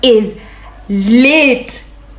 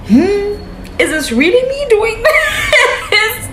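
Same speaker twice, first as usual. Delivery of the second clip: laughing disbelief; 11 semitones higher than usual. Retold or imitated. Dancing on table, self-doubt.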